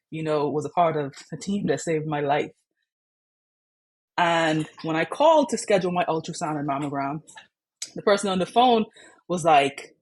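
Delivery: normal talking voice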